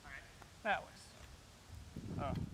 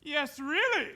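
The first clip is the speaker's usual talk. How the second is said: ordinary talk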